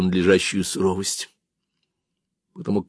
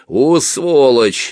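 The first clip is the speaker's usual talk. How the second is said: loud, drawn-out insult; sudden cursing; 6 semitones higher, 5 dB louder, much slower than usual. Acted. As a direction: curse him suddenly, high and slow. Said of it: "неожиданно выругал он меня". (ругательство, протяжно и громко)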